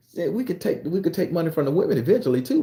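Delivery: ordinary talk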